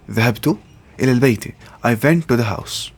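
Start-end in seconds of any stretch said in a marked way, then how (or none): 0.1-1.5 word by word. Shown as a demonstration. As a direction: split into two chunks with a pause.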